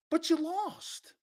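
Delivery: high, soft, slow comeback; high mocking retort. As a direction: toss it back high and slow. Retort to mocked fan.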